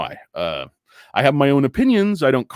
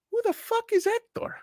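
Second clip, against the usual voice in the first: very high, soft mock-Spanish voice; telenovela jilted lover; 8 dB quieter than usual. Lover questioning the twin story, high melodrama. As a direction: squeak it out as a suspicious soap-opera lover. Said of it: "telenovelas on Spanish, uh, T V".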